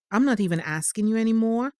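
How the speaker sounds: ordinary talk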